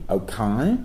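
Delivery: "okay" as broad Cockney "oh-kye"; hyper-Cockney. Retold or imitated. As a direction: turn the end of "okay" into a wide Cockney "eye". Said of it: "he uses a hyper-Cockney version".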